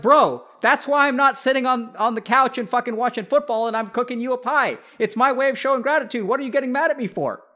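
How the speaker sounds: fired-up mock retort; heated comeback. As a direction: throw it back at them.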